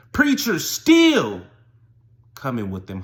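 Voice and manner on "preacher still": very high, loud, long-drawn cry; peak of refrain. Real, not acted. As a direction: belt it out, stretching each word.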